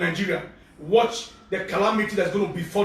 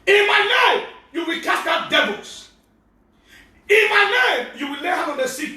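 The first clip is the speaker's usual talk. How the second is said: preaching at full force; 7 semitones higher than usual. Acted. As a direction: proclaim the promise. Quoting Jesus at full force.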